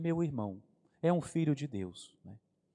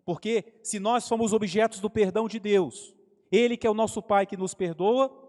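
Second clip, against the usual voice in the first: preaching; 4 semitones higher, 6 dB louder than usual. Acted. Preaching on God's forgiveness.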